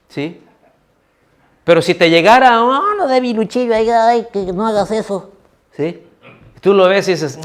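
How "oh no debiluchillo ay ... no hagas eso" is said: mock taunt. Mocking the strong teasing the weak.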